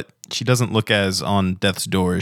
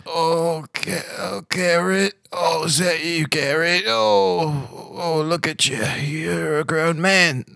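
high, drawn-out character voice; fond, dazed Pavilion; 9 semitones higher, slower than usual. Acted. Pavilion recognizes a grown-up Garrett, high and doting. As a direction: dote on him in a high, wavering voice.

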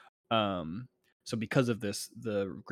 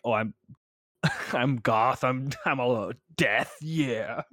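goth voice; 3 semitones higher, 9 dB louder than usual. Pretend. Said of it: "The other guy's like".

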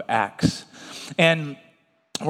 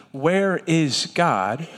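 slow weighty question; much slower than usual. Shown as a demonstration.

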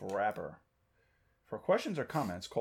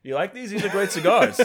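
higher, louder, laughing salesman pitch; hammed-up cigar fan; 9 semitones higher, 11 dB louder than usual. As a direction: oversell the cigar, hammy. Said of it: "we're gonna role play".

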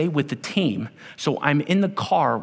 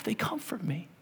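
breathy whispered verse, much softer; 13 dB quieter than usual. Shown as a demonstration. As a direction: whisper it like a secret prayer.

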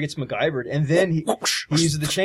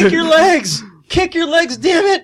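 high, loud orders; mock urgent yelling; 14 semitones higher, 9 dB louder, slower than usual. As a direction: yell it like urging someone to move.

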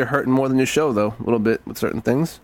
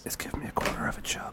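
whispering; 14 dB quieter than usual. Real, not acted. Joining the whispering.

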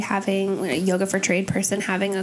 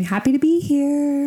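bright, higher sing-song teacher line; chirpy instructor; 5 semitones higher than usual. Shown as a demonstration. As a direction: cheerfully sell it to the class. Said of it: "I literally say all the time".

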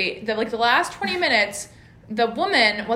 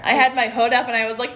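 usual speaking voice

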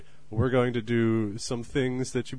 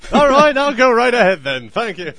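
loud high laughing acceptance; eager polite fly; 13 semitones higher, 14 dB louder than usual. Pretend. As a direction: laugh and gladly barge in first. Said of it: "two flies that are very courteous".

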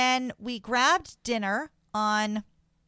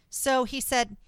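ordinary talk

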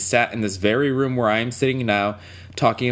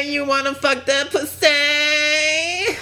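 porn star moaning. Pretend. Mock porn moaning high voice.

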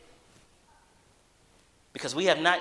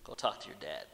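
small inner voice; 11 semitones lower, 12 dB quieter than usual. Retold or imitated. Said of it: "a small voice said to me".

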